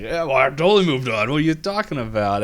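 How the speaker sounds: Robbie/character. Retold or imitated. Robbie denies he is heartbroken.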